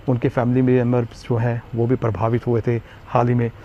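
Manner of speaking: ordinary talk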